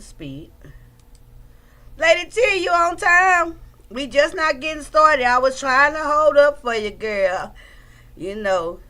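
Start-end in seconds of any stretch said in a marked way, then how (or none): 2.0-7.5 higher, louder holler to a friend; raised, drawn-up voice hailing someone; calling out a greeting. Real, not acted. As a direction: call out to a friend joining in. Greeting a late-arriving viewer.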